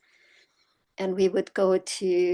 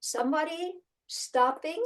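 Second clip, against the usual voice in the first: ordinary delivery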